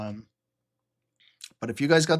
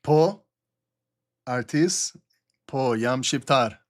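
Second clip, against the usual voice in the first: speaking Albanian; slower than usual. Real, not acted. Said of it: "just talking some Albanian".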